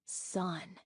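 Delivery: ordinary delivery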